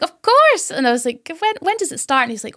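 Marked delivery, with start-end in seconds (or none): 0.0-0.7 high, bright-eyed Of course; delighted squeal of agreement; eager yes. Retold or imitated. Eager reply to free bar. 1.4-2.2 eager question. Retold or imitated. Eager about the bar opening.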